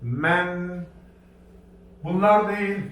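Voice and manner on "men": "men" with short e, closed on n; reading demo. Shown as a demonstration. Teacher to class, modelling "men". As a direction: give "men" as one joined syllable. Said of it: "üstün olsaydı".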